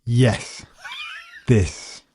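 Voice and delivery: usual speaking voice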